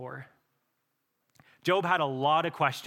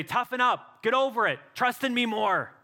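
louder, higher, stern scolding brush-off; harsh dismissal; 8 semitones higher, 5 dB louder than usual. Pretend. Preacher voicing an uncaring God, a stern rebuke. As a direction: bark it like a cold taskmaster. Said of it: "he doesn't look down on you and say".

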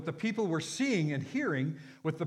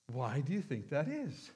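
ordinary talk, nothing marked

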